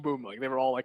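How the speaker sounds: usual speaking voice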